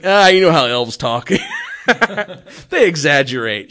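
laughing Santa. Retold or imitated. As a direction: chuckle it off as a caught Santa. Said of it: "He's like".

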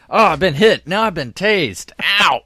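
loud, high hammed-up pain; mock getting shot. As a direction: yelp like a tased player. Imagining being shocked in laser tag.

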